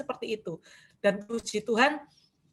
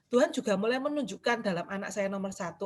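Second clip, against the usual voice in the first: normal talking voice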